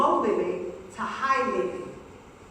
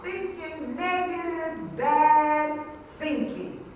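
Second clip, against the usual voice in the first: drawn-out emphasis; slower than usual. Acted. Slow sung emphasis.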